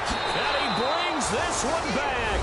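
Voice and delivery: high, excited touchdown call, key words stretched; thrilled sportscaster, slowing into the big finish; excited play-by-play. Real, not acted. Live call of interception touchdown.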